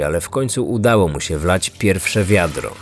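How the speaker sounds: usual speaking voice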